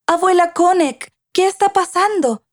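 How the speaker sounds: put-on high child voice, wondering; questioning young granddaughter. A narrator's reading. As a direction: pitch it up as a puzzled little girl. Liu questions grandmother as camp packs. (voz aguda de niña preguntando)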